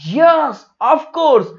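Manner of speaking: high, loud acted yes; emphatic confirmation. Brain confirms the right answer. (ऊँची आवाज़ में ज़ोरदार हामी)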